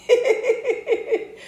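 a high burst of laughter; bright squealing laugh. Real, not acted. Laughing at own joke.

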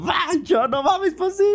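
high-pitched ecstatic yell to the dog; grito eufórico. Retold or imitated. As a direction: shriek the good news. Waking the dog with news. (grito agudo de alegría)

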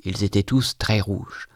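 ordinary delivery

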